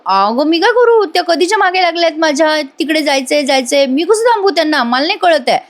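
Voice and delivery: high-pitched helpless protest; Arjun. Retold or imitated. Husband pressed to stop visitors, protesting helplessly. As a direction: protest as an exasperated husband, acted.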